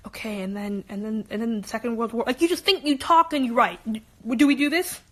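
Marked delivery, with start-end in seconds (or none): 0.1-2.2 low, soft mutter while writing; mumbling to oneself. Shown as a demonstration. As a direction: mumble it under your breath like you're thinking. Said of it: "you talk to yourself".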